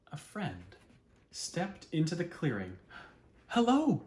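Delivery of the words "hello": high, louder call; cried out. A narrator's reading. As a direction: call out hopefully. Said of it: "cried the bear".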